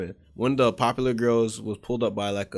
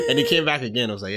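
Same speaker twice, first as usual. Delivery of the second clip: usual speaking voice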